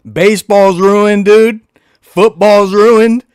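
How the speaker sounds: slow loud lament. Real, not acted. Fan lamenting the ruined seasons.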